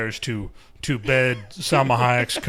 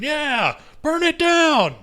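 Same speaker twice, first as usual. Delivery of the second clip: mock cheering; 4 dB louder, slower than usual.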